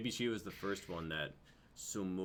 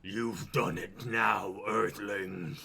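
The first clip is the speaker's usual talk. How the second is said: louder, slow, gloating menace; monstrous threat; 8 dB louder, much slower than usual. Acted. Transformed Tivo threatening the Earthlings, slow and loud. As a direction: savour the threat like a beast.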